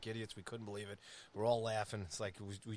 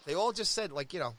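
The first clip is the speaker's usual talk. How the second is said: ordinary talk, nothing marked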